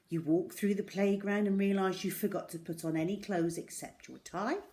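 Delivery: usual speaking voice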